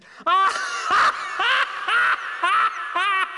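high, loud, shrieking belly laugh; shouted laugh. Acted. Dragon mocks the would-be murderer, shouted laugh. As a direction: howl with laughter. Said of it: "Laughed the dragon".

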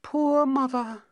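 high, slow princess voice; pitying princess. Acted. Princess on her mother turned rose bush, pitying. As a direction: lament it slowly in a high voice.

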